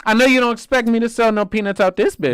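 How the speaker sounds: high-pitched, incredulous movie-quote impersonation; Tracy Morgan. Acted. Friend quoting How High for laughs, high comic impression. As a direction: quote the outraged line way up high. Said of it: "And Tracy Morgan goes".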